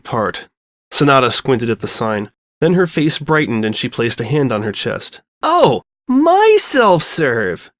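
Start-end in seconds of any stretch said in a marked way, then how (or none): 5.4-7.7 high, slow, laughing cry of realization. A narrator's reading. Sonata laughing at her own sign mix-up, drawn out and high. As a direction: stretch it out through a laugh. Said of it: "she laughed".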